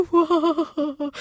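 high, drawn-out "ow"; pained high whine; whimpering wail. A narrator's reading. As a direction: moan in pain as the little wind. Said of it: "jammerte der Wind".

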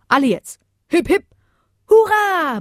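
high cheer building to a hurrah; roaring cheer. A narrator's reading. The crowd of Saint Bernards honours Bodo, cheering. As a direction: lead a cheer high and excited. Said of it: "brüllte die Menge der Bernhardiner".

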